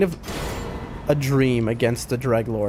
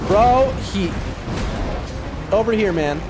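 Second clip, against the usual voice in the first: sudden high alarmed call; yelling in surprise mid-fight; excited shout; 6 semitones higher than usual. Real, not acted. Sudden alarm during boss fight.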